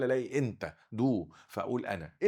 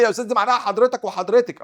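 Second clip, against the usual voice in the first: eager student asking, higher, louder and fast; student's question; 9 semitones higher, 9 dB louder than usual. Retold or imitated. Student interrupting the teacher with a quick eager question. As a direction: play a keen pupil objecting to the teacher. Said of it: "واحد يقول لي".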